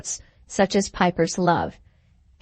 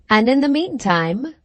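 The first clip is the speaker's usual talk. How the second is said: "meantime" pushed hard and higher; strong accent on meantime; 5 semitones higher than usual. Acted. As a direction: lean heavily on "meantime" to make the point. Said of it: "laying a strong accent on the word".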